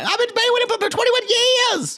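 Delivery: very high mock wail; hammed-up screechy impersonation, pitched sky-high; Lisa impression. Podcaster imitating a cast member lying, exaggerated high squawk. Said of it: "she's gonna sit there and lie".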